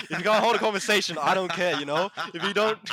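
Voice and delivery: laughing. Real, not acted. Laughing rant about grade boasters.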